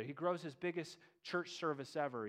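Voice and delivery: ordinary delivery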